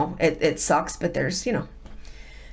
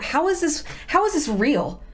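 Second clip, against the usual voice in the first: disbelief; 8 semitones higher than usual. Retold or imitated. Retelling her disbelief.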